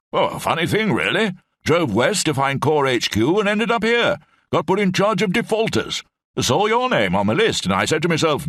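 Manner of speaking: put-on high, hearty old officer rambling on; blustering old brigadier. Old brigadier rambles to Lamb in the cell, high blustering voice.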